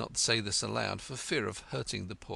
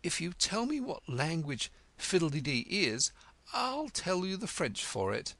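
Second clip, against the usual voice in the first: triumphant; 9 semitones higher than usual. A narrator's reading. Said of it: "she exclaimed triumphantly".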